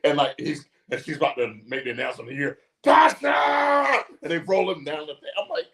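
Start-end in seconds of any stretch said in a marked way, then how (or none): 2.8-4.0 high, loud impression of a name-yeller; mock holler. Imitating a wrestler interrupting the ring announcement, mock holler. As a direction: bellow the name over the announcer. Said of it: "This bitch just hollers everybody".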